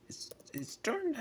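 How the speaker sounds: whispered aside. Real, not acted. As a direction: drop to a whisper. Aside in rant.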